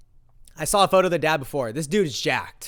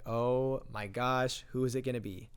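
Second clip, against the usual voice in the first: hushed dread; 3 semitones lower, 8 dB quieter than usual. Retold or imitated. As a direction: quietly dread who is coming, retold. Retells hearing footsteps, dreading who.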